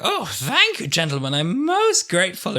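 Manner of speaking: high, exaggerated gratitude; mock grateful Doctor. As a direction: thank rescuers with hammed-up relief. Acting Doctor thanking his supposed rescuers.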